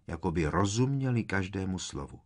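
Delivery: ordinary delivery, nothing marked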